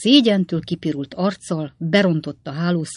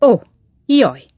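loud, higher startled yelp; sudden cry; 6 semitones higher, 9 dB louder than usual. A narrator's reading. Pickwick jabbed in the leg, crying out. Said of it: "kiáltott fel Pickwick úr".

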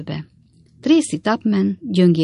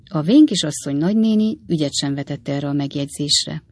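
usual speaking voice

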